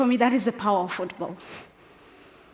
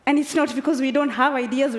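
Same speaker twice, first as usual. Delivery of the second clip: ordinary talk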